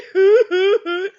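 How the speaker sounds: loud high laugh breaking into a sob; half laugh half sob; hysterical cry-laugh. Retold or imitated.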